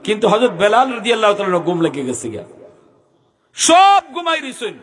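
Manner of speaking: ringing storytelling from the pulpit; impassioned sermon narration. Real, not acted. Preaches story in raised voice.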